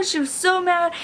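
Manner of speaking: usual speaking voice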